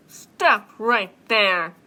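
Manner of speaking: loud, slow, booming bear; terrifying growl. Acted. Bear stopping the mouse, terrifying boom. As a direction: thunder it out one word at a time. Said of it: "she heard a terrifying sound".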